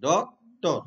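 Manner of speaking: Doctor in two beats, ডক, pause, টর; split into syllables. Shown as a demonstration. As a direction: break doctor after ডক, stressing that first beat. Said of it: "doctor has two syllable".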